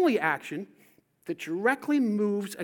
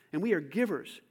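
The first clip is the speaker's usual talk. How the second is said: ordinary talk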